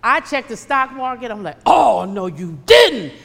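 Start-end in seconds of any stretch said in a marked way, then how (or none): 1.6-3.1 very loud mock outrage; outraged disbelief. Preacher reliving her check of the stock market, loud disbelief. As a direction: burst out in exaggerated shock.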